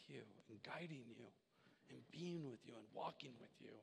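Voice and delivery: God offering help, hushed and tender; gentle voice of God. Pretend. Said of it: "he's up there saying".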